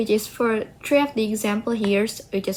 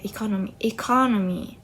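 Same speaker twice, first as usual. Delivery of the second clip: "economy" said twice with the stress on "co"; 3 dB quieter, faster than usual. Shown as a demonstration. Explaining the base word of economic, "economy" stressed on "co". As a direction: repeat "economy", leaning on the second syllable. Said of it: "the stress was on the co".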